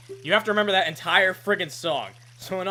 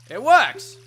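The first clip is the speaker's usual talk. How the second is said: much louder and higher It works!, cheered; triumphant shout; 6 semitones higher, 12 dB louder than usual. Acted. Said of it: "If it works, it works!".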